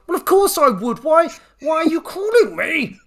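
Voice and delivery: patient losing patience with his doctor; exasperated. Retold or imitated. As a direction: demand the point of the call.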